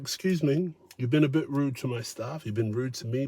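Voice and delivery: quiet firm waitress scolding customer; firm soft waitress. Retold or imitated. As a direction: calmly put the rude guest in his place. Waitress confronting rude customer.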